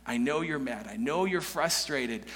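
a father soothing his angry son; tender father. Retold or imitated. Father tenderly comforting his angry older son, soft and low. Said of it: "starts speaking tenderly".

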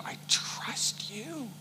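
breathy whispered I trust you, slow and faint; broken whisper. Pretend. Hannah whispering her trust to God, whispered. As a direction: barely breathe the words to God. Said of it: "but I can imagine".

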